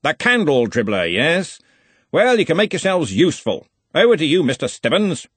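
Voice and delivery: put-on loud, hearty old wizard; booming, confident wizard boss; bluff archchancellor. A narrator's reading. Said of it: "he said".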